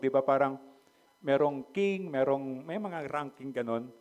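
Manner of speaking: ordinary delivery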